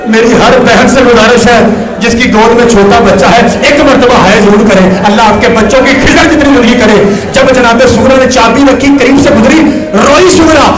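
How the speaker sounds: loud, fervent preaching at a climax; impassioned mourning sermon. Real, not acted. Preacher appealing to mothers in the congregation, loud impassioned lament. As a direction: rouse the congregation to wail.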